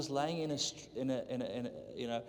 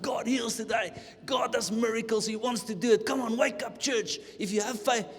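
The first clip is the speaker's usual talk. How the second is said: high, loud fiery preacher cry; rally the sleepy church, full force; preaching shout; 10 semitones higher, 7 dB louder than usual. Retold or imitated. Preacher to a sleepy church, loud rallying cry. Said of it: "Yeah, I was just going for it".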